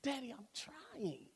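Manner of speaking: soft, hushed plea to his father; barely-voiced child's plea; pleading hush. Child to scolding father, hushed plea. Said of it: "I would look at him like".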